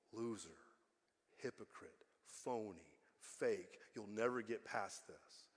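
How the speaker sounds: low, quiet condemnation; the enemy's quiet taunts in your head; accusing inner voice. Acted. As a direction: drop low and soft, accusing word by word. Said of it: "And I hear the voice".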